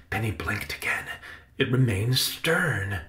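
usual speaking voice

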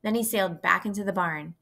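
ordinary delivery

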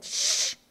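long hissed shush; urgent hushing sound; sharp shush. Acted. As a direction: shush him right in his face. Girl shushes him in his face.

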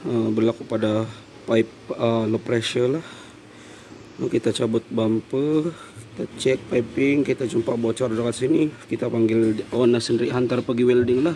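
normal talking voice

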